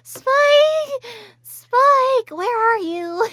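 put-on drunk Twilight. Retelling being a drunk Twilight at BronyCon, high wailing call. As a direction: call out like a lost drunk pony. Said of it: "I was like".

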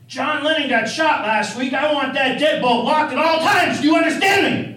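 high, loud ranting demand; yelling. Retold or imitated. Dave ordering his roommate, loud and high. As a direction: bark the order at him. Dave yells about the deadbolt.

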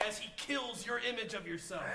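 ordinary talk

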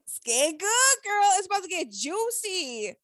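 high, hyped relish of coming drama; excited gossip. Real, not acted. Podcaster telling her girl it gets juicy, excited.

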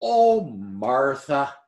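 very slow "Oh, Martha", stretched with a sigh; drawn-out "Oh, Martha". Shown as a demonstration. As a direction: sigh the name slowly with gentle reproach. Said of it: "that was the emphasis here".

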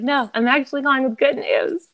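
a happy, light correction; cheerful. Retold or imitated. Doctor quoted with good news.

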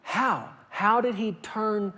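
normal talking voice

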